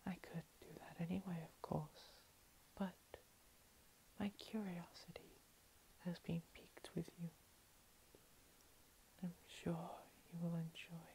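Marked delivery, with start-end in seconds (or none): none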